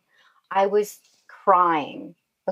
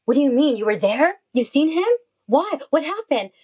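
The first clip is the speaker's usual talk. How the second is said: high, urgent voice of a stunned friend; retold shocked questioning; 7 semitones higher than usual. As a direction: press for answers, pitch climbing. Retelling friend's shocked questions on phone.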